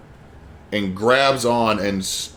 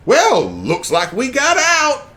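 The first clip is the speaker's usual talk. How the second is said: higher, slow Cajun character voice; Cajun accent; 13 semitones higher, slower than usual. Acted. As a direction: drawl it like a cheerful Cajun.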